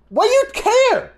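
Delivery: high, strained shout; retold yell. Yelling back at the aunt, high and strained. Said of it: "I'm paraphrasing half my sentence".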